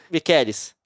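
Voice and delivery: Micheles said mi-KÉ-lis; Micheles pronunciation. Shown as a demonstration. Correcting the dictionary name for viewers. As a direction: say Micheles with a k sound. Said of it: "O certo é Micheles".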